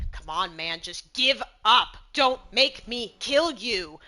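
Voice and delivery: exasperated plea rising to a loud, slow shout; annoyed, gritted teeth. Acted. Player's character urges a stubborn goblin to surrender, annoyed. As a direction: push each word out through gritted teeth. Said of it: "gritting my teeth in annoyance".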